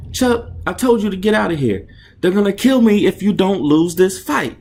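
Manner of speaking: high, loud desperate pleading; urgent shouting. Acted. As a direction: beg him loudly to leave. Fighter pleads with manager.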